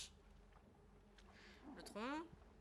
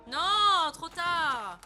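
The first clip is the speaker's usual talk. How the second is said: yelled high and loud in dismay; loud cry; 7 semitones higher, 17 dB louder, much slower than usual. Real, not acted. Gamer yelling as he gets caught too late. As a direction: shout out as it goes wrong. Streamer reacting to in-game death.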